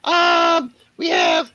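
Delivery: high cartoon dog announcer. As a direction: read the patron list as the dog. Sponsor thanks in dog voice.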